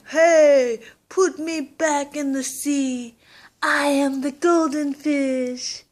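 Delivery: high, loud golden fish calling up from the sea; magic fish voice. Acted. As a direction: play the magic fish, big and loud, and slow down on the last line. Magic fish speaks to grandpa.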